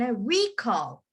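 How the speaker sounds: "recall" as noun, weight on "RE-"; stress on first syllable. Shown as a demonstration. Teacher rereads the example sentence, "recall" stressed on RE-. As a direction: hit the start of "recall". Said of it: "emphasize the beginning".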